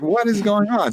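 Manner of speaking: high, incredulous what is going on; mock disbelief. Describing the Ricky surprise, high mock disbelief. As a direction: act baffled at the surprise visitor. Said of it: "It's like".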